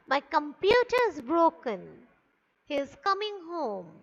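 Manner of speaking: statements dropping on "broken" and "home"; falling intonation. Shown as a demonstration. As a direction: let "broken" and "home" fall away. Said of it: "it is falling".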